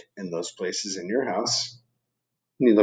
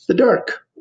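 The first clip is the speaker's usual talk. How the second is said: dramatic title; 11 semitones higher, 6 dB louder than usual. Acted. Dramatic title reading to kids.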